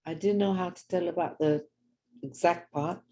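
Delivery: normal talking voice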